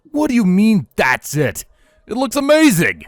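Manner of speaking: high, loud excited pony voice; bursting with delight; excited gushing. A narrator's reading. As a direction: gush over it, almost squealing. Excited stallion gushing over design.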